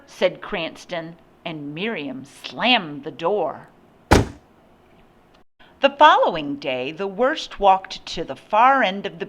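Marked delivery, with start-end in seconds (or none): none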